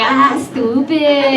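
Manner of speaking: slow, drawn-out East L.A. Chicano voice; East L.A. Mexican accent. Acted. Comic showing how they blend in, East L.A. accent. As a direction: stretch it out in a put-on East L.A. accent. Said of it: "East L A, I, I, I blend in".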